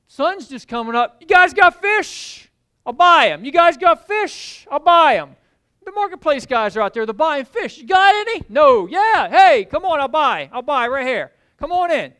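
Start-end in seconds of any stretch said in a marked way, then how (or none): 1.2-5.4 calling out. Acted. Fish buyer calls loudly to the boats. 7.8-12.2 loud, higher back-and-forth shouts; shouted haggling. Acted. Buyers and fishermen shout across the shore.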